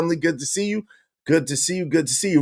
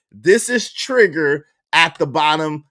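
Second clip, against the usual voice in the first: ordinary delivery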